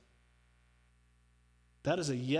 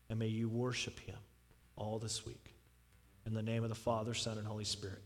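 closing prayer; 4 semitones lower than usual. Acted.

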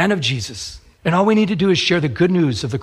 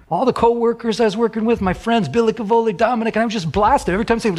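ordinary delivery

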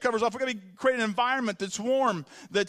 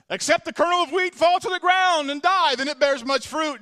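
blurted out loud and high; Jesus suddenly declaring it to the crowd, loud and high; sudden proclamation; 7 semitones higher, 7 dB louder than usual. Retold or imitated. Pastor voicing Jesus, suddenly loud proclamation. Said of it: "he just all of a sudden goes".

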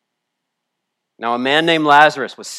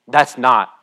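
ordinary delivery

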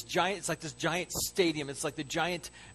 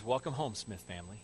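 low, soft TV host's welcome; imitated host, hushed and tender; gentle welcome; 7 semitones lower, 8 dB quieter than usual. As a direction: quietly welcome a family home. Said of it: "He says".

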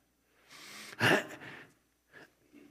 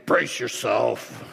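stern command; 7 semitones lower, slower than usual. Shown as a demonstration. God ordering Job to brace himself, stern and slow. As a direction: lower and slow, a firm order. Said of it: "how is he saying this?".

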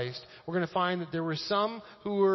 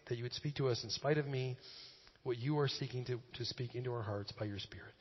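low, quiet, unhurried prayer; 4 semitones lower, 5 dB quieter than usual. Real, not acted. Pastor asking God for ears to hear, low prayer. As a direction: pray softly and humbly, voice sinking toward the amen. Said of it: "Let me pray quick".

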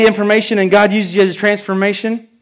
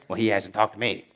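sulky aside; 11 semitones lower, 10 dB quieter than usual. Retold or imitated. Voicing a legalistic listener.

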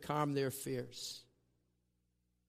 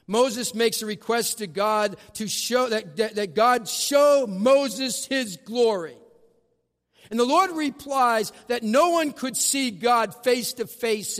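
rising, louder preacher's build; preaching; 7 semitones higher, 8 dB louder than usual. Acted. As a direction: preach it with growing force. Preacher builds the Exodus story.

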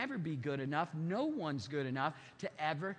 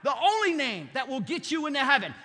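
preacher's closing crescendo; loud preaching; 5 semitones higher, 7 dB louder than usual. Real, not acted. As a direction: hammer the word only, loud and raised. Preaching climax.

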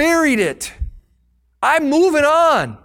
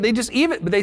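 ordinary delivery

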